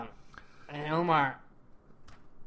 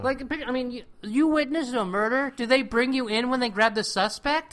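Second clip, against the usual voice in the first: incredulous; 10 semitones higher, 6 dB louder than usual. Real, not acted. Incredulous question about Omar.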